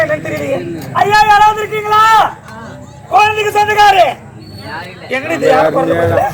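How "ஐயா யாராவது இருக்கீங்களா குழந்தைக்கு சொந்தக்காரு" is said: louder, higher call into the distance. Acted. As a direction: call out for the baby's family. Calling out for the child's parents. (குழந்தையின் உறவினரை உரக்க அழைத்தல்)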